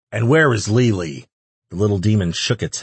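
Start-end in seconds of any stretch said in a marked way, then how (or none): 0.1-1.3 louder, raised interrogating voice; growled demand. A narrator's reading. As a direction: press the captive angrily for an answer. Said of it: "Ash growled".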